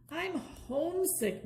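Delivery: slow homesick grumble; grumbling. A narrator's reading. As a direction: sulk about home. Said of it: "Max grumbled".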